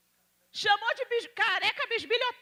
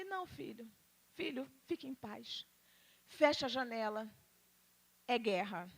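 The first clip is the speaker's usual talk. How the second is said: quiet calming; 9 semitones lower, 11 dB quieter than usual. Retold or imitated. Calming husband after insult.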